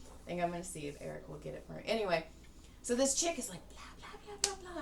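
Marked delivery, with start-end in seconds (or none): 3.7-4.8 high, breathy mock blah-blah; imitating an annoying talker's babble; mock chatter. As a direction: lampoon her endless chatter, pitched up high. Mocking chatter of the chick.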